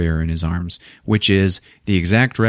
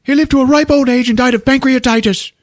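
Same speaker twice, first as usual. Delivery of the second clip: high, loud falsetto shouting; frantic shout; 5 dB louder than usual. Retold or imitated. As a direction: yell it out in frantic relief. Harry still shouting.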